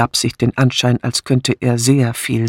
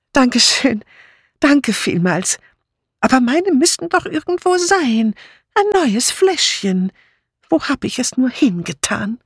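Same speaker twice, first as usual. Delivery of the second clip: muddled Dora, plaintive; 12 semitones higher than usual. A narrator's reading. Dora puzzling over her lost aspirin, plaintive. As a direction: fret in a high, querulous voice.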